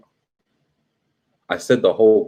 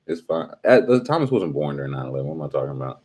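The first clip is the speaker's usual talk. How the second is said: usual speaking voice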